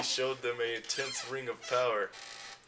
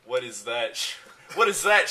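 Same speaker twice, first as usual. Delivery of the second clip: rising panicked shout on the second what; a sudden alarmed cry; 9 dB louder than usual. Acted. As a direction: yell it out, alarmed. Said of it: "shouts Ron".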